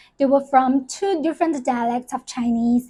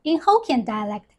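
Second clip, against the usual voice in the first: usual speaking voice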